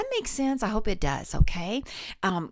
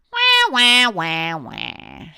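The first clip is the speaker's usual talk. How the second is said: bright louder wah, like a duck; quacking wah; 7 dB louder, much slower than usual. Shown as a demonstration. Voice teacher leading the quacking wah warm-up. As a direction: quack it out, loud and twangy. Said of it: "just kinda like you're quacking".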